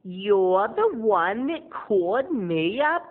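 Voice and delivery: mock-sweet sarcastic voice, drawn out at the end. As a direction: fake friendliness dripping with sarcasm. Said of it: "she gets real sarcastic".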